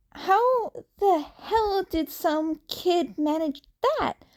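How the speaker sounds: put-on a flustered kid rushing through his theory; anxious teen hero stumbling over his words; nervous young Izuku.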